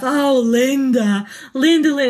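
slow mock-mournful call of Linda; lightly voiced lovesick bird crying for his wife; imitating a sad bird. Cartoon bird pining for his wife, mock-mournful. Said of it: "he's talking about his wife".

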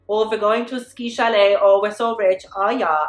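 lightly put-on German accent, snooty and rich; mock German accent. Vlogger mocking rich Chanel guests, light German accent. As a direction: play a posh German skier in a German accent. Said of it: "I have to stop doing German and, like, Dutch and all these accents".

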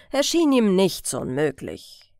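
ordinary delivery, nothing marked

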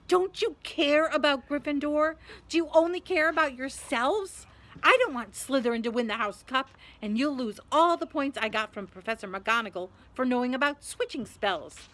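loud, high indignant questions; angry hissing scold. A narrator's reading. Hermione follows the boys through the portrait hole, scolding angrily. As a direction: scold them hotly, starting high and loud. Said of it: "hissing at them like an angry goose".

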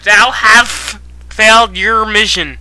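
usual speaking voice

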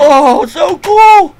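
high, slow, loud delighted shriek; excited shriek. Real, not acted. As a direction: squeal with delight at the find. TNT cannon found.

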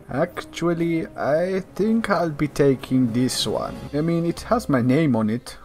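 a hint of Latin swagger; lightly put-on Latin accent. Gamer playing Rico, lightly put-on Latin accent. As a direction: talk as a cocky Latin hero.

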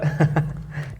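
quiet chuckle between words; soft laugh. Real, not acted. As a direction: chuckle under the breath. Laughs again mid-answer.

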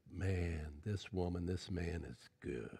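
low, soft marvelling; hushed admiration. Acted. Onlookers seeing the good inside someone, hushed admiration. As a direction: murmur it in quiet admiration.

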